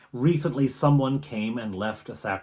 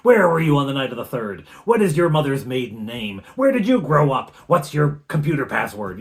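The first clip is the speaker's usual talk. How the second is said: rapid-fire louder interrogation; tough cop; 4 semitones higher, 8 dB louder than usual. Acted. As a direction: grill them like a tough cop. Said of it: "[name] goes into Perry Mason mode".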